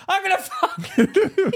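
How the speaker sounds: loud, very high mock shriek, breaking into laughter; imitated screaming threat. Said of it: "screaming, "Ah!" Screaming, fighting".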